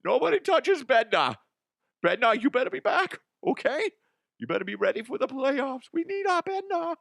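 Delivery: very high, silly character voice; high-pitched, hammed-up mock threat; unplaceable put-on voice. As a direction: squeal it in a made-up voice and accent. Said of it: "I don't know what voice this is or an accent".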